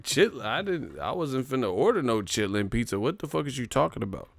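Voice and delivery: ordinary talk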